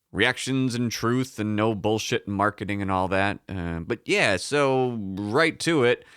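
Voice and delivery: ordinary delivery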